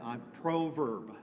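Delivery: ordinary talk, nothing marked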